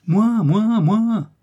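high, loud, piercing small voice; shrill little yell. A narrator's reading. Little mouse calling from the wardrobe, shrill yelling. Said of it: "hurla la petite voix pointue".

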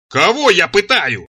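loud, drawn-out indignant yell; straining yell. A narrator's reading. He answers the torture accusation, straining to yell. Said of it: "заорал я".

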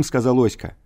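plain reading voice

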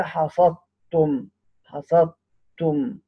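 very slow "حصدتم", the د turned into a held ت; idgham within one word. Shown as a demonstration. Teacher giving a one-word example, "حصدتم" slowly with doubled t. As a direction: say "حصدتم" as hasat-tum, lingering on the tt. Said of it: "مثال في كلمة واحدة".

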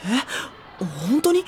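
jolted disbelief at the rumour; surprised. Acted. Hears about the curse rumour. (驚いて聞き返す)

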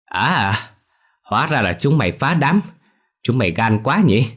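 put-on scolding old woman catching the kids; angry old woman. A narrator's reading. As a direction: catch them red-handed and scold.

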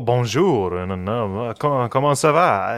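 put-on French accent on the greeting. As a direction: imitate your own attempt at a French accent. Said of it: "nice French accent you're putting on that".

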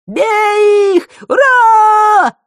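very high, very loud war whoop; battle cry. A narrator's reading. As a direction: yell it like charging into battle. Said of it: "громкий боевой клич".

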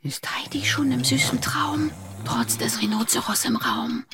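whisper. Acted. Child sneaking out at night.

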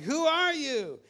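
high, loud questioning holler; alarmed shout back. Acted. Stranded climber to the unseen voice, high alarmed shout. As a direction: call it out loudly into the unknown. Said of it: "And you're like".

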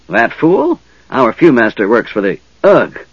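jeer, then get stabbed mid-word; shrill goblin. Acted. Goblin mocking the Theocrat while charging Tanis, shrill.

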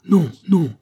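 high, loud cries of protest; emphatic shouted refusal; crowd shouting. A narrator's reading. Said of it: "cria-t-on de toutes parts".

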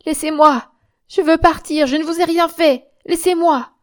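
high, loud protest; frantic pleading. Acted. Drenched, shivering Germaine begs her attackers, crying out frantically. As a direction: beg loudly to be released. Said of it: "elle ne put que balbutier".

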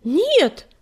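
high, loud protest; heated exclamation. A narrator's reading. Fireheart objects to the old cat, heated. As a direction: cry out a passionate denial. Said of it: "горячо воскликнул он".

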